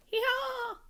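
very high, loud bleated answer; sheep in chorus. Acted. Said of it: "said the sheep all together".

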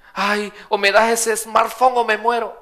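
mock a petty believer begging God; whiny believer.

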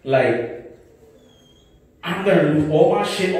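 ordinary delivery

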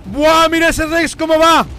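excited shout. Real, not acted. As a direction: call it out to the team, amazed. Excitedly calls out game action.

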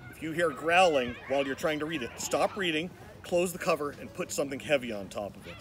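plain reading voice

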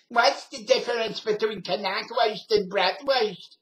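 high, loud, slow New York lady voice; New York accent. Retold or imitated. Retelling the lady's question, loud New York accent. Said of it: "had never heard a New York accent".

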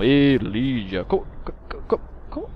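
stammering, slowed in disbelief; stunned stammer. Acted. Player stunned by Lydia's joke, stammering. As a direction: sputter out the question in shock.

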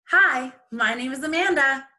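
bright upbeat self-introduction; cheerful. Acted. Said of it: "she said cheerfully".

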